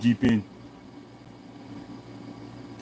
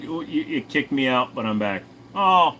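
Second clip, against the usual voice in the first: ordinary talk, nothing marked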